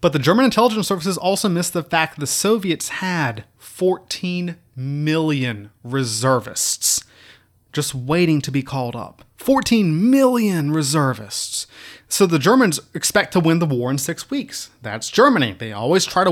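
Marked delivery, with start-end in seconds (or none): 3.6-7.0 "million reservists" stretched very slowly; drawn out for emphasis. Real, not acted. Lecturer stresses huge number. 9.4-11.7 "Fourteen million reservists" said slowly, weighing each word; slow emphatic repeat. Real, not acted. Lecturer repeats huge number.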